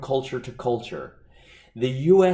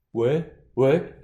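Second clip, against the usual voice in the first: curt French waiter; 3 dB louder than usual. Retold or imitated. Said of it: "I'll simply go".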